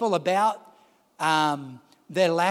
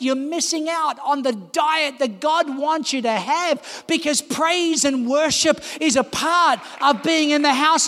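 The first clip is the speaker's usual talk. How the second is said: higher, louder, driving sermon voice; preaching at full force; 9 semitones higher, 4 dB louder than usual. Acted. Pastor challenges late churchgoers to be front row Christians, fervent preaching. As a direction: hammer the challenge home, building in fervour.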